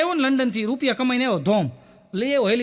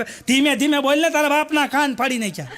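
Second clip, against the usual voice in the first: mock villager scolding; 4 semitones higher than usual. Villagers yelling at loud neighbour.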